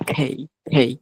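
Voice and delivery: "খেই" with air bursting after k; strongly aspirated "খেই"; airy K. Shown as a demonstration. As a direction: puff into each "খেই". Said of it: "বাতাস বের হবে আবার".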